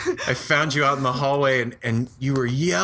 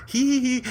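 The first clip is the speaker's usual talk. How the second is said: sly giggle; 11 semitones higher than usual. Retold or imitated. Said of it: "with that look like".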